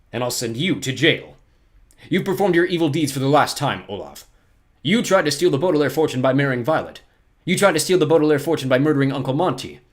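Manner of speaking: loud, firm banker laying down the law; stern official finally catching the villain; Mister Poe accusing. Acted. Poe confronts Olaf at the trial, sternly accusing.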